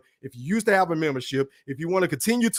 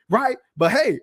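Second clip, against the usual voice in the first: ordinary delivery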